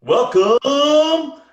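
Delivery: booming slow announcer, high and loud; big showbiz game-show intro. Shown as a demonstration. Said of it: "the announcer voice".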